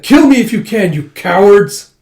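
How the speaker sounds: high, loud challenge slowing at the end; defiant taunt. Acted. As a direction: shout the dare, drag out cowards. Prisoner taunts soldiers.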